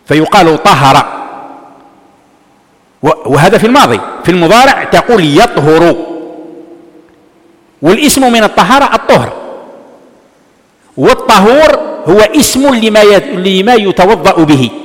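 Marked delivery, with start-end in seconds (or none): 0.6-1.1 "طهر" said slowly with a short a after the ه; فتح الهاء. Shown as a demonstration. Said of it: "لكن الفتح أفصح". 11.0-12.0 "والطهور" with an a after the ط; فتح الطاء. Shown as a demonstration. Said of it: "الطهور بفتح الطاء".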